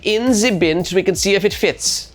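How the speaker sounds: put-on playful German accent as a pushy flight attendant; mock German flight attendant insisting, lightly; a hammed-up German accent. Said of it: "It's my German accent".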